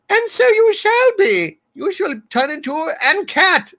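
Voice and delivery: very high-pitched put-on proclamation; mock wish-granter.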